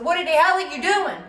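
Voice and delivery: high, loud angry mother's yell; mama yelling. Retold or imitated. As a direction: shout it like a furious mom at dawn. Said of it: "somebody screaming and yelling and hollering".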